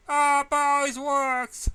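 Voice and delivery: high, loud, drawn-out cry; loud protest. A narrator's reading. Pinky shouting back at teacher, high, loud and slow.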